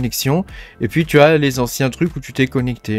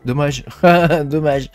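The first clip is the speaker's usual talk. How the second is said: amused chuckle. Real, not acted. Forgot to save during the demo, amused chuckle. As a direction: laugh between the words.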